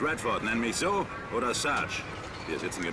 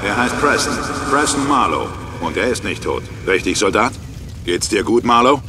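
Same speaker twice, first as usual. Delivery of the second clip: louder, rising to a yell on Marlowe; battle shouting; 14 dB louder than usual. Acted. Sergeant shouting to dazed recruit during ambush, loud. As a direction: shout it over the explosions.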